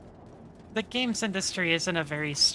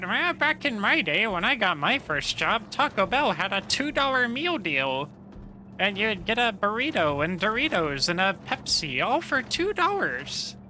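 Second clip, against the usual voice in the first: high, swooping mock-boomer rant; 4 semitones higher than usual. Streamer parodies himself ranting about prices, mock boomer voice. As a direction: rant like a put-on old-timer, pitch leaping up. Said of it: "I probably sound like a fucking boomer".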